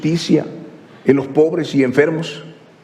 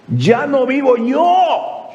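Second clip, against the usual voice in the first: high, slow preaching proclamation; ringing pulpit declaration; impassioned scripture declamation; 9 semitones higher, much slower than usual. Acted. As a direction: proclaim it slowly, pitched high, drawing out each word. Preacher declaims Paul's words emphatically.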